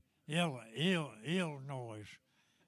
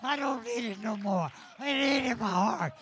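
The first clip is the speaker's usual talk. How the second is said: preaching; 5 semitones higher, 8 dB louder than usual. Acted. Preacher testifying at full force.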